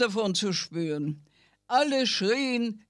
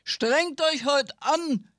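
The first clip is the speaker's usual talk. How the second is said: lions roaring; 6 semitones higher than usual. Acted. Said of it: "brüllten die Löwen".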